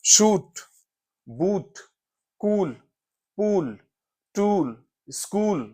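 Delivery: "shoot, boot, cool, pool, tool, school" with a held "oo"; teacher's word list, long "oo" in each; long vowel examples. Shown as a demonstration. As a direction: say each word alone, stretching the "oo". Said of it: "we have used source of energy".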